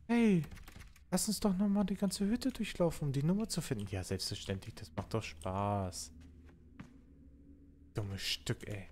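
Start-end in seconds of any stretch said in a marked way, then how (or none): 0.1-3.9 high, chirpy mock host; sing-song fake cheer; mock cheerful voice. Mocking the game host. 3.9-6.1 sarcastic. Real, not acted. Sarcastic reply to own mock.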